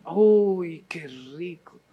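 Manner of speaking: savoring it. Acted. Preacher to listeners, savoring a tasty bite in a murmur. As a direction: let the flavor melt on your tongue.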